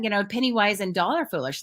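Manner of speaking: ordinary talk, nothing marked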